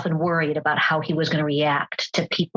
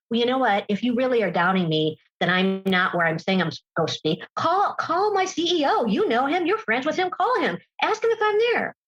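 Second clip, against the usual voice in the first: higher, goading dare, mildly mimicked; mock-defiant abuser; 4 semitones higher than usual. A speaker imitates a typical abuser daring his partner to call his CEO, higher and goading. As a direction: dare her to check up on him.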